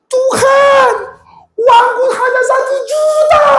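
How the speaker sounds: very high, sustained cry to God; shouted prayer. Retold or imitated. Man alone in his car crying to God about money, shouted prayer. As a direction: belt out a desperate plea way up high. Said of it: "Saya teriak".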